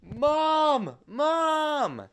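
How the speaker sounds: high, loud yell of Mom; excited shout. Retold or imitated. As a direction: call her from the next room, thrilled. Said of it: "my mom, I shouted".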